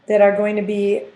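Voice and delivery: usual speaking voice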